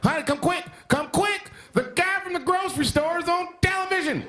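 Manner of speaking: high-pitched, hammed-up calling to a spouse; mock a starstruck viewer calling out urgently; excited farmer at home. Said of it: "They're probably, like, at home like".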